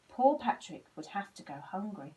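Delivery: ordinary delivery, nothing marked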